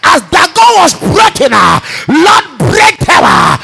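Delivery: shouted fiery prayer, every line hammered; full-force preaching. Acted. Preacher leading the congregation, shouting prayer at full force. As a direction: pray over the church at the top of your lungs.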